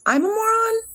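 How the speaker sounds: mock-astonished high squeal; incredulous echo. Real, not acted. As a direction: voice leaping high on "moron". Echoes a viewer's insult.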